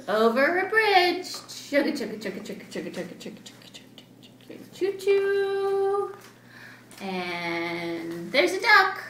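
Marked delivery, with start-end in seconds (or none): 1.2-4.3 chugga chant fading to a whisper; fading train chugga. Acted. Train sound fading away. 4.5-6.1 slow singsong train whistle; sung choo choo. Acted.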